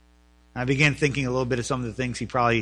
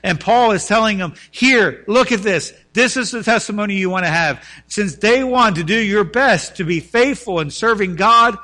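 loud, raised-pitch sermon delivery; preaching at full force; 8 semitones higher, 10 dB louder than usual. Acted. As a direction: drive it out to the congregation.

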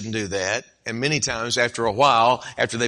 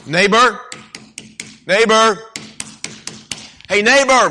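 loud, much higher call through the door; 9 semitones higher, 7 dB louder than usual. Acted. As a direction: call up to the sleeping house. Knocking at neighbor's door at night.

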